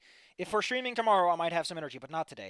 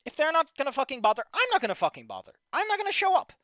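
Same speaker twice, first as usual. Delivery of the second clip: loud, fed-up outburst; angry rant; 5 semitones higher, 7 dB louder than usual. Real, not acted. Streamer fed up that nobody bothers, angry. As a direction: punch the words, voice jumping up.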